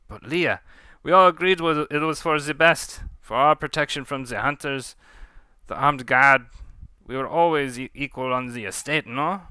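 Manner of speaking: put-on French-accented appeal to an old ally; pleading French captive. Pontou reminding Leah of their pact, pleading.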